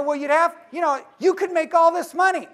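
overexcited salesman push; pushy pitch. Retold or imitated. Arguing with pie man, acted.

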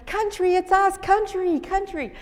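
high, loud excited calling out; kids yelling cheers. Retold or imitated. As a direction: call out like thrilled little girls. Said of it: "start yelling cheers to this guy".

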